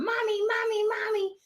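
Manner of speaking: put-on high, calling child's voice; imitating kids. Mother imitating her kids calling at the door, high. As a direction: call out like a small kid at the door. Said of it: "my kids that wanna come".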